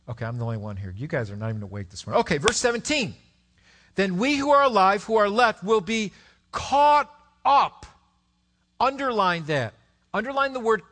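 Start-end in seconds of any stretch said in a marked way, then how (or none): 3.9-7.9 loud, slow proclaiming; proclaimed scripture. Acted. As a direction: ring out each phrase. Reading scripture loudly.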